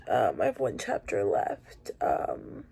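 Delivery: normal talking voice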